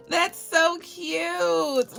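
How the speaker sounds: high, very drawn-out delight; gushing. Real, not acted. Vlogger seeing panda necklaces, gushing slowly. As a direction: stretch it out in a high coo. Gushes over gift.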